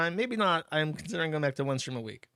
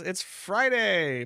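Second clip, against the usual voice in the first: long drawn-out, higher, louder cheer; excited cheer; 5 semitones higher, 5 dB louder, much slower than usual. Acted. Streamer reading a viewer's Friday message, cheering it out slowly. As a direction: stretch it out like a party shout.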